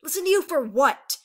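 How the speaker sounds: loud, high, heated challenge; angry retort. A narrator's reading. Aurora rounding on her tearful sister, furious. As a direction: fire back, hurt and furious.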